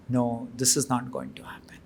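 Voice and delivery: hushed, broken whisper; whispered refusal. Acted. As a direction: whisper it as if losing hope. King's plea fades to whisper.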